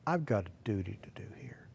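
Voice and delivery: hushed whisper of resolve; whispering to himself while praying. Retold or imitated. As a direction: breathe it like a private vow. Quiet resolve in prayer recounting.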